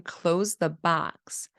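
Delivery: "Close the box" slowly, words separate; words kept apart. Shown as a demonstration. Therapist spelling out what the child means. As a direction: say each word of "Close the box" clearly. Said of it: "So they're saying".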